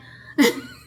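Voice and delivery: high, loud laugh at herself; burst of laughter. Real, not acted. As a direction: laugh it off at your own joke. Laughs at her own joke.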